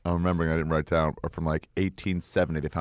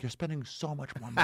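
high, quieter nagging voice; mock scolding critic; 6 semitones higher, 6 dB quieter than usual. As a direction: nag him about the cost.

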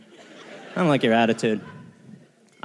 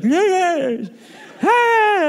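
high hammed-up yeah yeah ah; mock grunting English; much slower than usual. Mocking old English folk with high grunts. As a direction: squeal it like a toothless yokel.

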